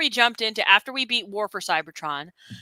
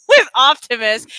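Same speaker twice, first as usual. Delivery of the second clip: usual speaking voice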